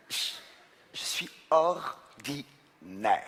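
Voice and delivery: slow mock-dramatic whisper; hushed fake confession; confessional whisper. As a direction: whisper it like a shameful secret. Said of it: "c'est un sketch".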